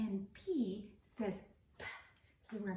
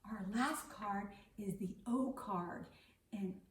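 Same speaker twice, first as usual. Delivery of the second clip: ordinary talk, nothing marked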